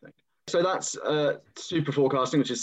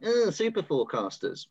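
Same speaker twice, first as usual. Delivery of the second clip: mock-snide sneer, pitched up; 7 semitones higher than usual. Host mimicking snide people on Twitter, snide. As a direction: imitate a snide online scoffer. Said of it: "people snidely on Twitter going".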